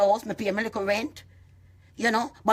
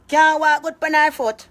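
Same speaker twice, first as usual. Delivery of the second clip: high, loud, slow ridicule; mocking shout; 7 semitones higher, 8 dB louder, slower than usual. Real, not acted. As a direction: jeer it out louder. Mocking aunt's age.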